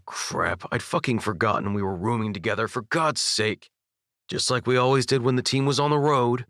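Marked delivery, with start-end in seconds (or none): none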